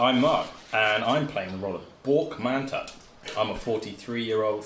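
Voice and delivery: normal talking voice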